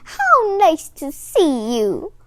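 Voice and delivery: high, loud granny greeting; delighted exclaim. A narrator's reading. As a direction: cry out in happy welcome as the old woman. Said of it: "Booba Branya exclaimed".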